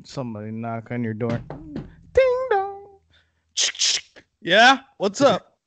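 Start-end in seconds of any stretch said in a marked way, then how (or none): 2.1-3.0 high sung doorbell chime; mimicking a doorbell to start a skit; doorbell ding dong. Imitating a doorbell. 4.4-5.6 mock door answer.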